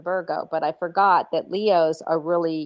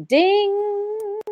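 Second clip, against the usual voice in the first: excited ding; 14 semitones higher, much slower than usual. Acted. Announcing the job win.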